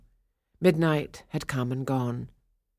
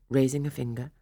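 usual speaking voice

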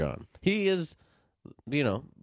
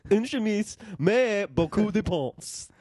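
put-on higher, playful Franglais with French accent; mock French; 7 semitones higher than usual. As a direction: ham up French, drawing it out.

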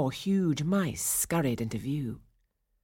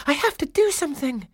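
high, raised, desperate resolve; urgent alarm; 11 semitones higher, 6 dB louder, faster than usual. A narrator's reading. Clara, desperate to save cornered Nutcracker, urgent and alarmed. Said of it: "Clara thought".

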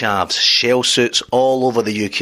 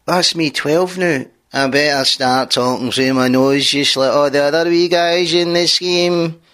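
nasal whiny Ned; 3 semitones higher than usual. Acted. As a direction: whine it nasally like a young Glasgow Ned. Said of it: "talk in that nasally, whiny voice".

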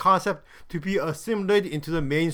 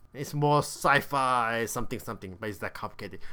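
soft, low, trailing-off muttering; dismissive mumble; 7 semitones lower, 9 dB quieter than usual. Real, not acted. Reviewer gives up explaining the other show, muttered low.

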